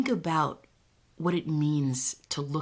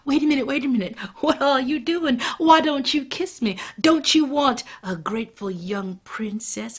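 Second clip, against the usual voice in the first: louder, higher, hurried plea; nervous pleading; 7 semitones higher, 7 dB louder than usual. Acted. Frog pleading with the old man, louder nervous plea. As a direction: beg him to stop.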